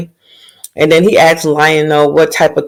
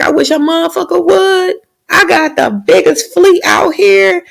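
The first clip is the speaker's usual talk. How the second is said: pitched-up cocky brag, lightly voiced; mock boastful husband; 13 semitones higher than usual. Husband brushing off wife's warning, mock cocky high brag. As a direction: puff up like a bragging old sea lord.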